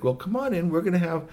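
a hint of a busy host; lightly voiced hostess. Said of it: "So Shui Huang said".

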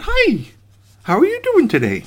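very high, squeaky little cookie voice; cheery gingerbread boy. Acted. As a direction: chirp brightly like a tiny cookie come alive. Gingerbread boy greets the gardener.

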